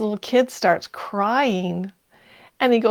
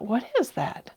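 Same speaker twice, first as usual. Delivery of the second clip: puzzled hush; 3 semitones higher, 9 dB quieter than usual. Retold or imitated. Puzzled inner thought.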